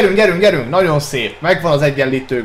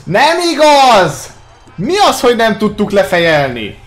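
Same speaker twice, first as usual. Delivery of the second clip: disbelieving outburst; 6 semitones higher, 4 dB louder than usual. Real, not acted. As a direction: shout in disbelief at the miss. Missed header, disbelief.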